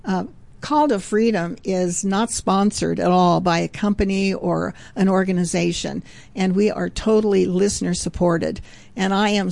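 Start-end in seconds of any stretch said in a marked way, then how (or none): none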